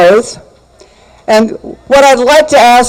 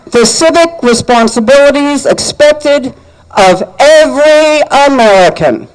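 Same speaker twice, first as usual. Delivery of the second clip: solemn oath reading. Acted. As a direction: read the oath with measured gravity. Leads the citizen's oath.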